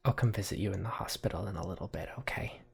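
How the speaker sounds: soft, quick, fading to a whisper; subdued promise. Acted. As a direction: let it trail into a whispered okay. Lily quietly parts from injured friend.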